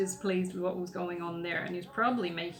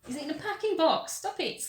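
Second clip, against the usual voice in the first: higher-pitched, exasperated telling-off; real exasperation, pitched up; exasperated dog scolding; 8 semitones higher, 4 dB louder than usual. Real, not acted. Scolding the dog chewing a box.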